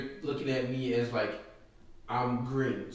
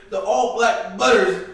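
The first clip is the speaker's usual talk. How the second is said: mock brag; 8 semitones higher, 11 dB louder, slower than usual. Bragging about his boots.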